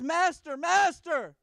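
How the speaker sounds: shrieking high and loud for his master; frantic high shout. Acted. Igor bursting in on the organ-playing master, high panicked yell.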